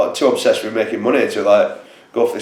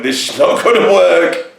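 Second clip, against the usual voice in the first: mock worried officer; 5 semitones higher than usual. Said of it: "Charles Talon is there with his three hundred men going".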